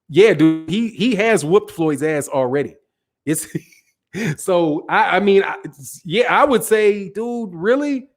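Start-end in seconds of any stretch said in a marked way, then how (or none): none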